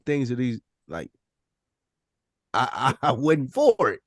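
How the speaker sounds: ordinary delivery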